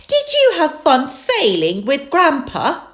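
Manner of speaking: high, loud storybook mum; Mummy Pig. A narrator's reading. Mummy Pig welcomes the children home, high-voiced. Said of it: "Mummy Pig asked".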